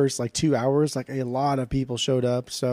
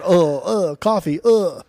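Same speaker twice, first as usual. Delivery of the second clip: higher, louder grunty British caricature; mock English grunting; 4 semitones higher, 5 dB louder, slower than usual. Imagining English people walking around, mock grunting. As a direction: grunt round the word like a cartoon Englishman. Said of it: "Do people do that in, in England".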